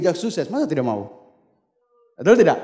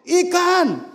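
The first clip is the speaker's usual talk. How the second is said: calling out; 11 semitones higher, 5 dB louder than usual. Retold or imitated. Calling out fish while selling.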